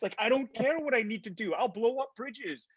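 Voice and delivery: high, excited retold yes; eager acceptance. Actor answering Lucasfilm's availability call, over-eager. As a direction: gush it like a fan who'll do anything.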